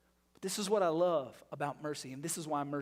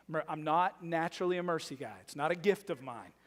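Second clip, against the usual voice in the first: ordinary talk, nothing marked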